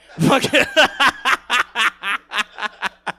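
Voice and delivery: loud, high burst of laughter; big laugh. Real, not acted. Comedian cracking himself up at his own joke, laughing loud.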